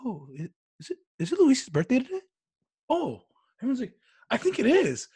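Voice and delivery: high, put-on wondering voices; mock-innocent surprise. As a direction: play innocent, as if just realizing it. Mock-innocent team before birthday hazing.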